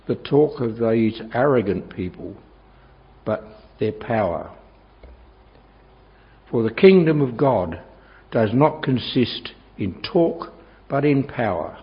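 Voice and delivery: ordinary delivery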